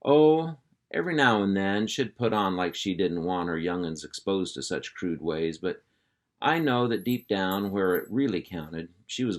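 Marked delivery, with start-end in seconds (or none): none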